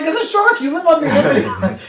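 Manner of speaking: high, laughing, fast retort; laughing exasperation. Retold or imitated. Mocking islanders about sharks, high laughing exasperated retort. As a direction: fire it back, cracking up.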